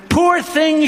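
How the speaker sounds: high, lightly voiced tender woman; a hint of a pitying woman's cry; mock pitying woman. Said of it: "she cried".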